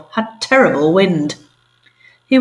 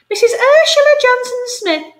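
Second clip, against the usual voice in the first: very high, grand falsetto; grand rich lady; 4 dB louder than usual. Acted. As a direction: introduce yourself like a grand dame. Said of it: "said the large lady grandly".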